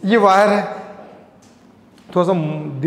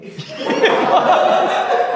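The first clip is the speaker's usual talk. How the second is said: high breathless laugh; 11 semitones higher than usual. Real, not acted. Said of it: "क्या जोर था बे?".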